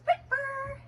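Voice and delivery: high, loud call of the dog's name. Real, not acted. Calling the puppy by name.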